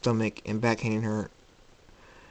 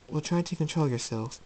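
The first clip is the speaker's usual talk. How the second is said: higher, gentle reproof; princess, mildly reproving; 5 semitones higher, 4 dB quieter than usual. Acted. Celestia to cranky Jacob, gently reproving. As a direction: chide lightly in a higher voice.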